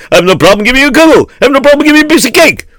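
fast, rising indignant rant; shaking with anger. Acted. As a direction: rattle it off, climbing in fury. Host rants, escalating.

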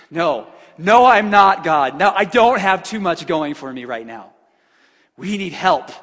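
loud, desperate no no, pushing back at God; loud pleading protest. Acted.

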